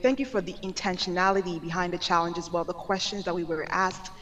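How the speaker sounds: usual speaking voice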